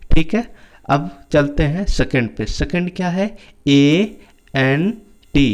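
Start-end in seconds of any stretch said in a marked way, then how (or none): 3.7-5.5 very slow letter by letter spelling. Shown as a demonstration. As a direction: stretch out each letter for the kids. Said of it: "teacher spells word slowly". (बहुत धीरे-धीरे अक्षर बोलना)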